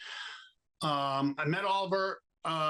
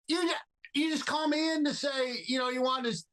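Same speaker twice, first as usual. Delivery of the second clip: indignant outburst; 9 semitones higher than usual. Retold or imitated. Actor protesting to the director at the audition, indignant outburst. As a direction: throw it back at him, fed up and incredulous.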